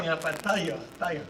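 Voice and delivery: low, soft offhand mumble; imitating brother's casual talk. Preacher imitating his brother shrugging off unemployment, low offhand mumble. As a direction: toss it off like a laid-back guy. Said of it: "falar assim".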